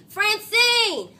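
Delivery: loud, high exclamation of her name; sharp call. Acted. Arthur cutting off Francine's bragging, loud sharp call. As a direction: cut her off by shouting her name.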